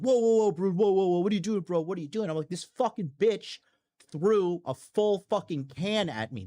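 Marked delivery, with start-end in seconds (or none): 0.0-2.3 high, loud mock panic; imitated alarmed bros. Imitates men stopping him at party. 2.5-6.4 higher, slow indignant protest; retold outrage. Retells angry protest at thrown can.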